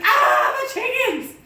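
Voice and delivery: high, loud cry of dismay; mock wail. Gracie wailing over her chickens, high and loud. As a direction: wail it like a ruined cook.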